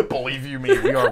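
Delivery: high, loud laughter through the words; can barely get it out for laughing; laughing hard. Real, not acted. Laughing mockery of friend.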